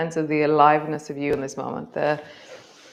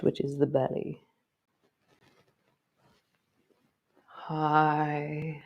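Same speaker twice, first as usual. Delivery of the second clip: guided movement cue. Acted. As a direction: guide the body gently through each move. Leads a guided movement practice.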